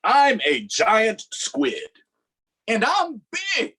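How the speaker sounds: slow, loud, high boast; giant squid boasting. Acted. Squid bragging about its size, slow and loud. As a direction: stretch it out big and proud. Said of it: "squid's lines, slow and loud".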